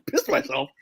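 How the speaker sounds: storyteller laughs while quoting his own excuse; laughing quote. Retold or imitated. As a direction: try to say it but laughter takes over. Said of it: "I'm like".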